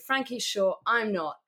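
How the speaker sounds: normal talking voice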